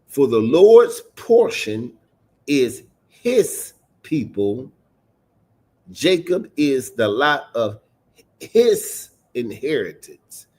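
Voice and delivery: slow reading, punching "portion" and "his"; emphatic scripture reading. Shown as a demonstration. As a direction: hit "his" hard, with pauses between phrases. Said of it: "you hear me emphasize on certain words".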